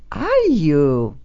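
normal talking voice

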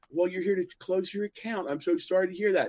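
lightly voiced fake sorry bank clerk.

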